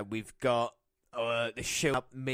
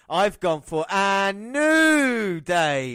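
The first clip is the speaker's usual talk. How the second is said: slow, loud, higher reveal; drawn-out announcement; 6 semitones higher, 8 dB louder, much slower than usual. Real, not acted. Host revealing his pick to co-host, slow loud drawn-out reveal. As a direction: stretch out the pick for effect.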